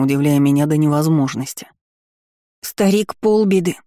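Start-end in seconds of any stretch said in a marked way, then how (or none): 2.6-3.8 higher-pitched, mildly tearful outburst; tearful child. A narrator's reading. Boy in tears to the countess, tearful. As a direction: cry it out with a touch of tears. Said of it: "обливался слезами мальчуган".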